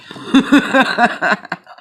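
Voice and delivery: a loud high burst of laughter; big high laugh. Real, not acted. Laughs at own joke.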